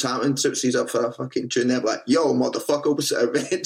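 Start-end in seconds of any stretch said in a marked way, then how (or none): 2.1-3.6 higher, laughing American rapper greeting; mock American hype voice; American rapper accent. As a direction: ham up an American stage persona. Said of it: "I spoke like this".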